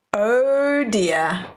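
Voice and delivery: plain reading voice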